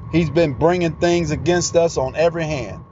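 ordinary talk, nothing marked

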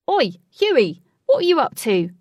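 high, loud doorman's call; challenging shout. A narrator's reading. Doorman to Huey after hearing screams, challenging shout. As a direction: holler it over accusingly.

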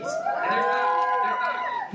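sudden falsetto squeak, slow and breathy; whispery high-pitched gush; high squeal. Acted. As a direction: squeak it out like a delighted kid. Comic squeal over cookies.

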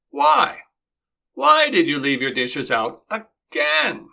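Why jumps high and loud in exasperation; exasperated why. Shown as a demonstration. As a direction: demand an answer, exasperated. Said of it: "your spouse asks".

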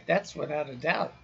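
usual speaking voice

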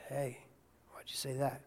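hushed, gentle rebuke from within; a quiet voice asking why; soft inner voice. Retold or imitated. The Spirit quietly questions the pastor.

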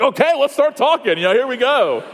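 pumped that the door finally opened; excited. Retold or imitated. Preacher to congregation, retelling his eager excited reply.

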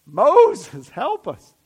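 loud desperate cry for help. Retold or imitated. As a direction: call out to Moses in panic. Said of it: "crying out".

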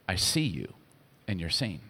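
soft and low, gently assuring her; tender reassurance. Acted. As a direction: speak it like a gentle whisper of comfort to a hidden woman.

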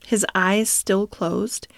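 plain reading voice

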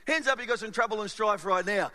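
usual speaking voice

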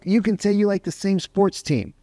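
ordinary delivery

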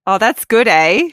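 lightly mock her Canadian boyfriend. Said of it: "He's like".

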